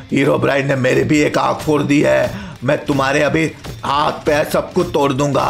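low, menacing boss voice; deep cartoon-villain threat; threatening villain principal. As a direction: play the evil principal swearing revenge.

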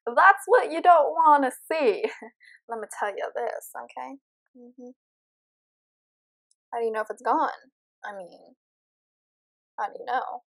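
ordinary talk, nothing marked

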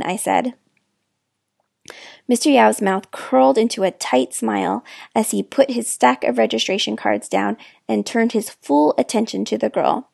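usual speaking voice

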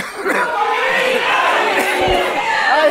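loud high laugh; burst of laughter. Real, not acted. Teacher laughs during the shouting exercise. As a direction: crack up between the shouts.

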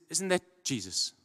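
ordinary talk

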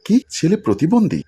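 ordinary delivery, nothing marked

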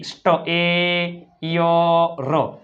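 very slowly stretch સ્ટ-, એ-ય-ર; long held vowels in સ્ટ-, એ-ય-ર; drawn-out pronunciation demo. Shown as a demonstration. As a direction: sound out સ્ટ-, એ-ય-ર piece by piece. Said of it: "એનો ઉચ્ચાર શું થાય?".

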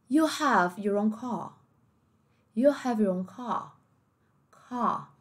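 slow, careful British English, no final r; British accent. Shown as a demonstration. Teacher demonstrating British accent to students, slow and careful. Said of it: "como é que ficaria o britânico".

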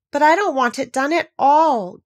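high, loud, mildly acted groan; exasperated storybook moan; groaning complaint. A narrator's reading. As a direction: wail it lightly, like a put-upon queen. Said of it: "groaned the poor Queen".

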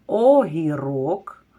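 огірок drawn out slowly; slow example word for children; slow careful word. Shown as a demonstration. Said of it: "Слухайте".

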